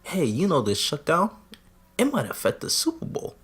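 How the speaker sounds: hushed, confidential aside; mock whisper. Someone whispers a warning in his ear, hushed and sly. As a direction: drop the volume like tipping someone off. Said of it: "someone whispers in his ear now and says".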